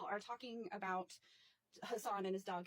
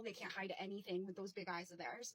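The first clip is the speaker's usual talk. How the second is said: normal talking voice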